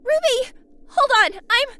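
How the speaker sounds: loud, high cry of alarm; urgent shout. Acted. Weiss crying out to Ruby as she falls, loud urgent shout. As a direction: yell to a friend who is falling.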